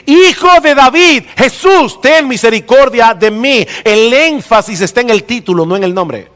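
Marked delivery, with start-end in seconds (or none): 0.1-3.7 shouting. Retold or imitated. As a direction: shout the title first, then "Jesús". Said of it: "comenzó a gritar y a decir".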